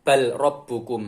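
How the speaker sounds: "Bal Rabbukum" with the l sounded; as written, unmerged. Shown as a demonstration. Teacher reads it as written, lam separate. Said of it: "Nah, di sini tulisannya".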